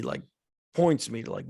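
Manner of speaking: ordinary delivery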